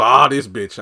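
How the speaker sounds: loud exclaimed mock dismay; mock exasperation. Priscilla as she walks in, loud mock exasperation. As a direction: burst out as the fed-up character. Character's exasperated reaction acted out.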